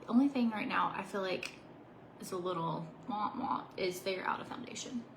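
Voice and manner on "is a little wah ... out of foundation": whispered aside, voice dropped; whispering. Real, not acted. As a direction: whisper it like a secret. Whispered aside about bad news.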